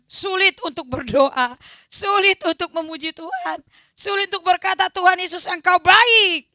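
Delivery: loud preaching to the congregation; full-force preaching. Acted. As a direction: drive it home to the church.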